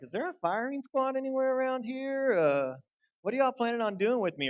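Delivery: high-pitched, hammed-up worried questioning; mock nervous. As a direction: pretend to fret about your fate in a high voice. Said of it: "I'd be nervous. I'd be like".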